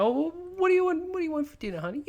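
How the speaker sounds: hammed-up doting mother asking her child; mum's voice. Mocking a friend by playing mum offering dinner in high falsetto. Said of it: "as mum might serve up to you".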